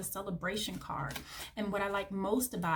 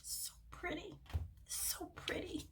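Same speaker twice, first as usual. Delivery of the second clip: breathy hushed whisper, very soft and high; awed whisper; 10 semitones higher, 13 dB quieter than usual. Real, not acted. Reader whispers in awe at the beautiful cards.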